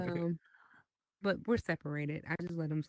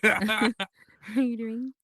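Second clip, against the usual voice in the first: drawn-out, louder playful greeting with a laugh; slow, exaggerated hello in a teasing singsong; signature catchphrase greeting; 4 semitones higher, 7 dB louder, much slower than usual. Shown as a demonstration. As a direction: stretch the greeting right out, pitched up and amused. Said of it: "Why you say it like".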